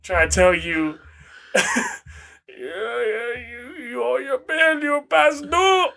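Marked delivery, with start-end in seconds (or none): 1.5-5.9 high-pitched mock Bangladeshi debt collector; Bangladeshi scammer accent. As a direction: scold the debtor in a put-on Bangladeshi accent. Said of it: "this motherfucker is in Bangladesh".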